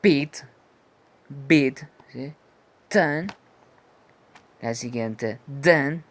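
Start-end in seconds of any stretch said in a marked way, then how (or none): 0.0-3.3 pit, beat, Tan read out loud and crisp; teacher modelling English words; clear model words. Shown as a demonstration. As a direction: model each word clearly for copying. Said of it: "ejemplos claros como".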